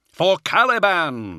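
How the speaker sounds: high, loud, drawn-out battle cry; slow, ringing high shout; answering war cry. A narrator's reading. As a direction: shout back as a whole squad would. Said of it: "the Astartes answered".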